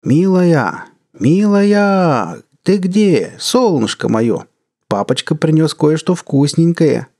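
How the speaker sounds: high, sing-song calling out; calling sweetly. A narrator's reading. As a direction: coax her out like a doting dad. Father calling for his creature.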